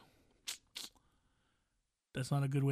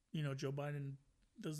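ordinary delivery

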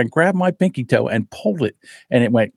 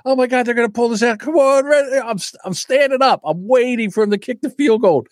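high, hurried, eager anticipation; pitched-up and keyed up; retold excitement; 8 semitones higher, faster than usual. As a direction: relive a fan's hopeful excitement. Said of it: "I was so excited".

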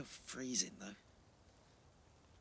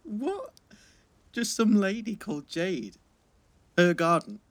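incredulous; 10 semitones higher, 8 dB louder, slower than usual. Acted. Baffled by Jade's garden.

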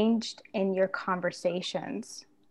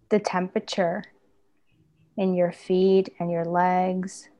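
guided meditation; 3 dB louder than usual. Acted. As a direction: gently guide them into the body. Leads a body-scan meditation.

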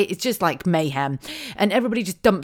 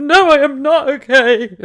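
loud, high, drawn-out wail breaking into laughter; hammed-up falling apart; mock wail; 9 semitones higher, 11 dB louder, slower than usual. Mum answering the teacher at drop-off, mock wail.

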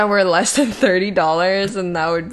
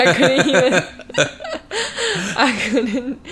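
higher, broken up by real laughter; cracking up, restarting the sentence; laughing while talking; 4 semitones higher, 3 dB louder than usual. Real, not acted. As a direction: try to get the words out through giggles.

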